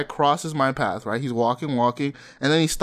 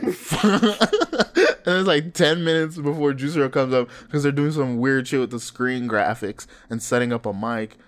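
normal talking voice

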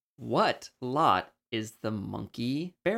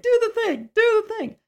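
much higher, louder excited parent voice; eager proud parents; 8 dB louder than usual. Retold or imitated. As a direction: squeal it like parents begging for the party trick. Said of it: "They'd be like".

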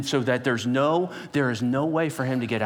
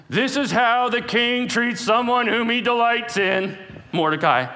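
high, loud mock herald cry; mock proclamation; 9 semitones higher, 5 dB louder than usual. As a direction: bellow it like a herald mocking Haman. Haman forced to proclaim.

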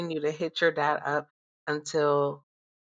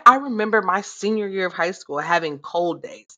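ordinary delivery

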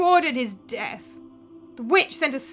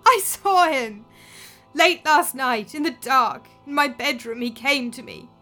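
haunted tearful telling; 5 semitones higher, 8 dB louder than usual. Acted. Daughter telling the crowd of her father's ghost, tearful and haunted. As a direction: relive the ghost's visit with dread.

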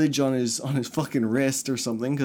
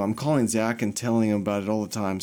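normal talking voice

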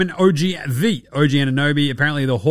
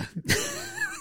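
squeaky laugh; 11 dB quieter than usual. Real, not acted.

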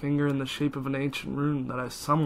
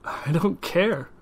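higher, louder, slow defiance; defiant; 5 semitones higher, 4 dB louder, slower than usual. Acted. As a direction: spit it out slowly. Defiant shout at demon.

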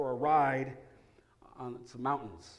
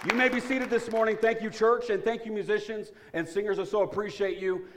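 higher and louder, beaming thanks to the church; loud, lively welcome; 7 semitones higher, 5 dB louder than usual. Real, not acted. Pastor thanking church and musicians, loud and beaming. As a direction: lift the room with thanks.

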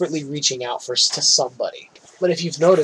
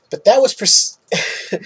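ordinary talk

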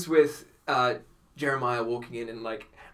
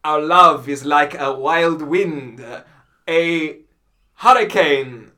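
slow, louder declamation of a love line; grand romantic recital; 2 semitones higher, 10 dB louder, slower than usual. Retold or imitated. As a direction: recite it like an overblown lover. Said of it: "he had a decent pause".

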